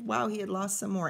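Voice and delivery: usual speaking voice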